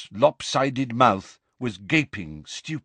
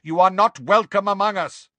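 stern centaur; 8 semitones higher, 5 dB louder than usual. Acted. Centaur warns giant.

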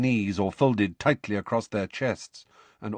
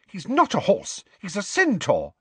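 put-on much higher, shocked girl's correction; 11 semitones higher than usual. A narrator's reading. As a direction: correct her, scandalised. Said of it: "sounding shocked".

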